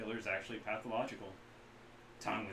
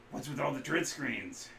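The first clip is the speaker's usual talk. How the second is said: ordinary talk, nothing marked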